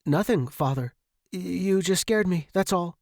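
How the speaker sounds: stammered, hasty excuse; flustered son making excuses to his father; nervous boy. A narrator's reading. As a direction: cover up like a caught kid. Caught boy answers father nervously.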